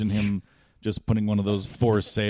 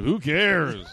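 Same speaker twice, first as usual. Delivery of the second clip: mock defiant; 6 semitones higher, 4 dB louder than usual. Drunk comic to crowd.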